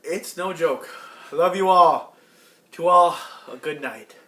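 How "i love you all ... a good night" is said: grand farewell. Real, not acted. Farewell to the audience.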